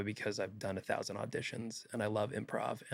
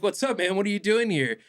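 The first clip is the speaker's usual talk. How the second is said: friendly greeting; 9 semitones higher, 6 dB louder than usual. Retold or imitated. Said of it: "he's like".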